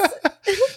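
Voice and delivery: squeaky burst of laughter; high-pitched laugh. Real, not acted. Laughs at teasing.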